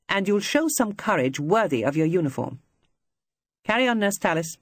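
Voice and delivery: clipped, commanding matron voice; stern ward sister. Acted. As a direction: rebuke him like a strict matron. Stern ward sister rebuking airman.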